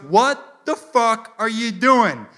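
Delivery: slow, loud, word-by-word cop fury; high, drawn-out angry demand; imitated angry cop. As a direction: spell out the outrage slowly as a cop. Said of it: "he storms up to my car".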